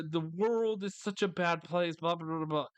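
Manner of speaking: usual speaking voice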